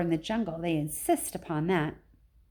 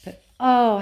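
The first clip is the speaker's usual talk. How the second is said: a much louder, higher "oh"; emphatic oh; 4 semitones higher, 9 dB louder than usual. A narrator's reading. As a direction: burst the "oh" out loud. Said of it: "narrator's emphatic exclamation".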